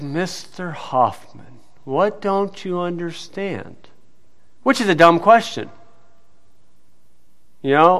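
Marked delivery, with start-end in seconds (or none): 0.0-3.8 imitated exasperated judge. Judge rolling his eyes at Mister Hoffman, exasperated. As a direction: spell it out slowly, with hushed, strained patience. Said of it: "He rolled his eyes and said".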